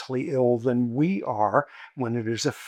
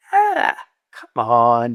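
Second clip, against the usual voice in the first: scoffing; 2 semitones lower, 7 dB louder, much slower than usual. Retold or imitated. Teller scoffs at friend.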